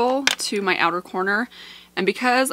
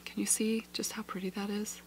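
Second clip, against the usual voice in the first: whispering; 13 dB quieter than usual. Real, not acted. Whispered aside to viewers.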